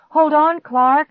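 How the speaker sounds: high, loud strained call; attempted shout. A narrator's reading. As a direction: call out urgently. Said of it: "I tried to shout".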